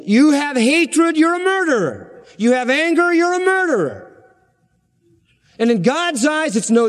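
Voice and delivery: fiery pulpit accusation; preaching at full force. Acted. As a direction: drive it home like a revival preacher.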